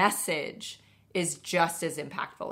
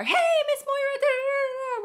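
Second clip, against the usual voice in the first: much higher, bright sing-song hello; excited teen greeting; 7 dB louder than usual. Retold or imitated. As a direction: greet her like a bubbly thirteen-year-old. Teen hops in car greeting.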